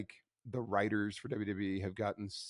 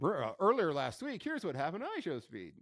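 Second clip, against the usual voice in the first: higher, hammed-up recap voiceover; mock recap announcer; 7 semitones higher than usual. Podcaster joking to co-host about a TV recap, mock announcer. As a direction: imitate a TV recap intro, pitched up.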